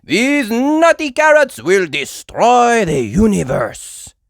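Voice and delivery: loud, slow hammy hero; Super Potato voice. A narrator's reading. Super Potato warning of the carrots, loud and grand. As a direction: declare the danger grandly. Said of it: "shouted Super Potato".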